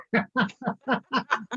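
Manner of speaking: high, giggly burst of laughter; delighted laugh. Real, not acted. Delighted gushing compliment to co-host.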